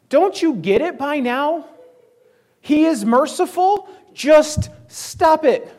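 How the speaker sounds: high, loud exasperated outburst; exasperated prophet. Retold or imitated. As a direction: slam it down like he's run out of patience. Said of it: "the full exasperation".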